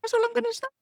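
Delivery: very high, squeaky mock-coy teasing; squeaky tease. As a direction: squeak it out like a secret you won't share. Teasing repeated refusal to tell.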